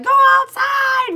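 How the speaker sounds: high, loud, slow order to the dog; ordering the sick dog outside, loud high call. Retold or imitated.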